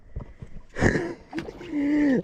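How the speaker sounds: high gleeful laughter; thrilled laugh. Real, not acted. Angler laughing after the redfish popped up close, high glee. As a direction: laugh out the excitement.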